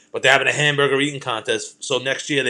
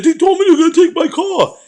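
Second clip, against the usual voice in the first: much higher, louder whine; panicking friend; 8 dB louder than usual. Retold or imitated. Friend whining about his car, high and loud. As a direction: squeal it like he's losing it. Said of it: "losing his mind".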